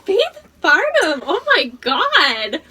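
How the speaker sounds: loud, high, slow, excited. Real, not acted. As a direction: draw out each word in delight. Excited retelling of reaction.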